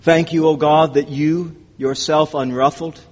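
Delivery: low, hushed prayer to God; closing prayer. Real, not acted.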